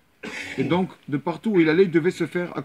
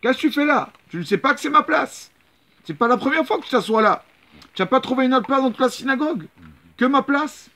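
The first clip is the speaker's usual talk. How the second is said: high, loud outraged yelling at a stranger; angry shouting; 11 semitones higher, 5 dB louder than usual. Retold or imitated. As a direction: bawl him out for taking your seat. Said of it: "Il a commencé à crier".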